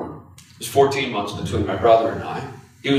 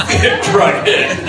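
laughing; 7 semitones higher, 6 dB louder, much slower than usual. Real, not acted. Laughing at his own story.

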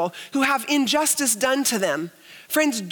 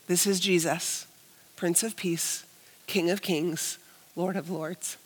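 softer and lower, almost prayed; hushed reverence; 5 semitones lower, 7 dB quieter, slower than usual. Acted. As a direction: let each title sink in quietly. Reverent reread of the sign.

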